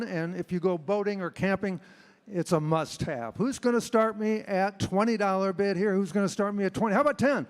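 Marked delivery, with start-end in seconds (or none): 3.4-7.5 fast rhythmic auction chant; auctioneer. Acted. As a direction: rattle off bids, loud and high. Auctioneer bid calling.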